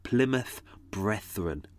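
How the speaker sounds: Plymouth Brethren said slowly, each syllable clear; slow, deliberate Plymouth Brethren; careful pronunciation. Shown as a demonstration. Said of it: "It's a really hard thing to say".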